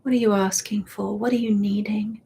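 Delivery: ordinary talk, nothing marked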